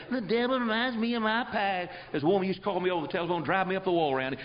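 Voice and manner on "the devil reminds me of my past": high, far-off nagging whine of a caller; whiny caller mimicry. Preacher mocking a caller who whines about her past in a high whine. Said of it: "like talking to a fence post".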